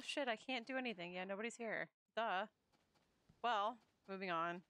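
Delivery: normal talking voice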